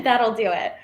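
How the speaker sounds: usual speaking voice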